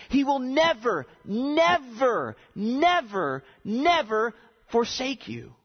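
each "never" pitched high, then falling; emphatic repetition. Real, not acted. Preacher promising God will not forsake you, insistent stress on "never". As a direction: drive every "never" home as a separate blow.

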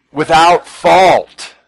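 very slow, high and loud; stress on fault. Shown as a demonstration. Preacher stressing to listeners that God gives without fault, slow and loud. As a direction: stretch without fault out hard.

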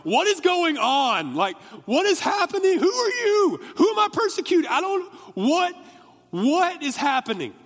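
high, loud hysterical screaming; panicked freak-out. Acted. Saul to the unknown voice from the light, panicked shrieking. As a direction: cry out in total bewilderment, words stretched. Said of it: "I imagine it more being like".

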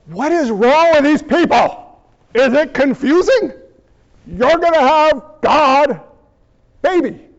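emphatic mock frustration, spelled out in chunks; exasperated angel. Gabriel re-explains to confused people, in mock exasperation. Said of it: "Gabriel's gotta be like".

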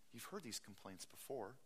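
ordinary talk

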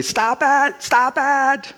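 put-on high, shrill-pitched old woman pleading "stop it"; old lady protesting. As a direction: play the frail old lady who can't bear to hear it. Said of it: "she would say".

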